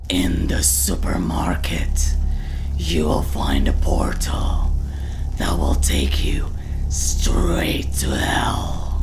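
low, loud growly rattle, slowing at the end. Shown as a demonstration. Teacher demonstrates the talking exercise, rattle voice. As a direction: talk through a rough demonic rattle.

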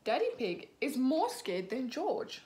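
ordinary delivery

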